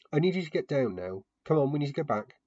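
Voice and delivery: firm, urgent call to come down. A narrator's reading.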